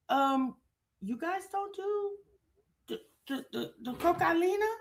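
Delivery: soft, matter-of-fact question. Retold or imitated. Livia asks the group in a soft, matter-of-fact voice. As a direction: say it like it's nothing unusual. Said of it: "said that so matter of factly".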